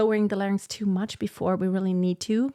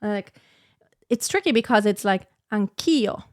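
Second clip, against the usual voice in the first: ordinary talk, nothing marked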